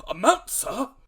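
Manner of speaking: very high, loud indignant shout; the squire scoffs at the doctor's question with a loud cry; incredulous cry. A narrator's reading. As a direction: shriek the word in disbelief. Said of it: "cried the squire".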